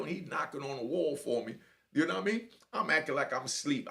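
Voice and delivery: ordinary delivery, nothing marked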